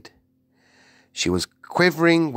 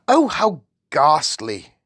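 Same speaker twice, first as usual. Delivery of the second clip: higher, louder royal exclamation; horrified cry; 6 semitones higher, 6 dB louder than usual. A narrator's reading. The Queen cries out over the newspaper, horrified. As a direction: cry out aghast. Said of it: "the famous voice cried out".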